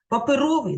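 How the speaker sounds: "паперовий" with the stress on РО; "паперовий" said as па-пе-РО-вий; stress on third syllable. Shown as a demonstration. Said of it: "на третьому".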